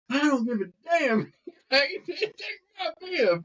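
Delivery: high, laughing yell; retelling a partner's mid-race outburst, laughing; retold yell. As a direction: yell it while cracking up at the memory. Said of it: "started screaming at me".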